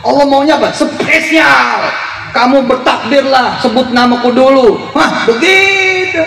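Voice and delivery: pitched well up, lightly teasing; high playful voice. Acted. Voicing what Allah wants.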